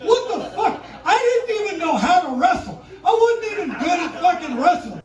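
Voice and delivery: high, loud, worked-up stage delivery; shouted stand-up storytelling. Acted. Comedian telling crowd of wrestling days, shouted and fired up. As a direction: tell it fired up, punching each phrase.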